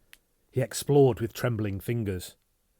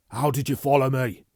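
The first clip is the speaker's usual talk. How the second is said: urgent demand; 4 semitones higher, 7 dB louder than usual. Acted.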